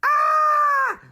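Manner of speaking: loud, very high comic scream; president's scream. Acted. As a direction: shriek as a panicking president. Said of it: "So the president said".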